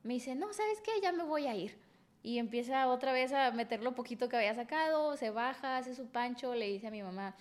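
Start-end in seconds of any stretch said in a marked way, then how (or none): 0.4-1.8 high, huffy mock of the boyfriend. Retelling her ex announcing he's leaving, high mocking huff. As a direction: toss it off like a sulking boyfriend.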